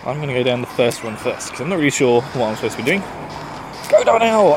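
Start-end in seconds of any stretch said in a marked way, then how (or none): 3.9-4.5 loud, high cheer; calling out. Real, not acted. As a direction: shout it like a trainer. Sends out Pokemon in game.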